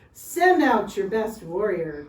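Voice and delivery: loud commanding order from the giant. A narrator's reading. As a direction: bark the order to the army. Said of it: "Goliath ordered".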